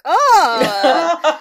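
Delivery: loud, high, shocked oh; horrified gasp. Retold or imitated. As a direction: gasp like you caught them kissing. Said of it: "looks kind of horrified".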